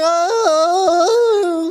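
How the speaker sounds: high whimpering "Oh."; a high mock whimper; crying beggar. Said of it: "saw a guy in the middle of the road crying".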